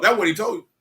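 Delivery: ordinary talk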